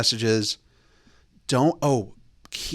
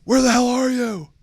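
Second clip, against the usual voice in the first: much higher, louder frantic call; mock panicked text voice; mock alarmed yell; 13 semitones higher, 5 dB louder than usual. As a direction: play a hair-on-fire emergency. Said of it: "it's something like".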